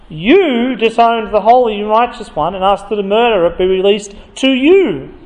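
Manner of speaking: every "you" punched and held; stress on YOU. Shown as a demonstration. Preacher reading to the crowd, stressing "you". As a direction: lean hard on each "you". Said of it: "note the emphasis on the word "you".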